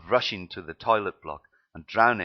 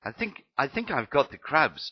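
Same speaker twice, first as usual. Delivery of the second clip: blurted out; 8 semitones higher than usual. Acted. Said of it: "Joey managed to blurt it out".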